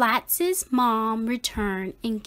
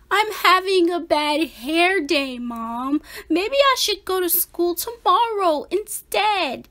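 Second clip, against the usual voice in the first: put-on higher kid voice, pleading; Splat's excuse; 7 semitones higher than usual. A narrator's reading. Splat pleading with mom to skip school. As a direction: beg to stay home. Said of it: "said Splat".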